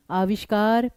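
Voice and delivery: ordinary delivery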